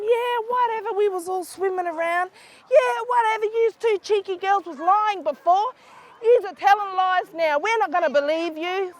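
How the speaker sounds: dismissive mockery. Kids mocking the girls' warning.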